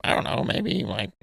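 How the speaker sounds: unvoiced unsure reply; a half-whispered mumble; whispered shrug. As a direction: breathe it out like a nervous minion. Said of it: "he's just like".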